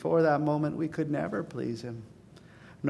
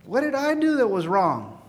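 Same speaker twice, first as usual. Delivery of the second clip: put-on pleading child; 9 semitones higher, 5 dB louder than usual. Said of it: "It's sort of like saying".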